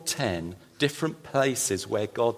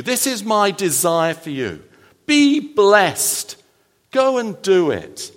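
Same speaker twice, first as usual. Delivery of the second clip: higher, building to a joyful charge; God's rousing blessing; 8 semitones higher, 4 dB louder than usual. Acted. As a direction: send them out with fire. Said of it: "The blessing is".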